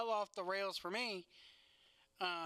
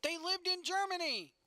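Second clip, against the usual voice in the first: high-pitched, exasperated outburst; indignant protest; 10 semitones higher, 4 dB louder than usual. Real, not acted. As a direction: fire back defensively, pitch way up. Protesting when challenged on his remark.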